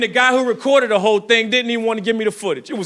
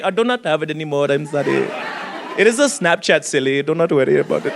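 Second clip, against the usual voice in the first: Indian accent imitation; 8 semitones lower than usual. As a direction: brush him off in an Indian accent. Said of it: "Are there any Indians in here? How did I do?".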